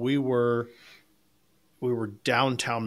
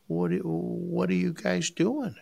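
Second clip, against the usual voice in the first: put-on little old lady voice; 7 semitones higher, slower than usual. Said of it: "she comes up and goes".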